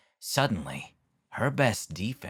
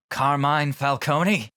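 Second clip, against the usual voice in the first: louder, higher, rising questioning guess; nervous guess; 5 semitones higher, 6 dB louder than usual. Acted. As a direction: blurt it out as an anxious question. Riddler pressed to guess, threatened.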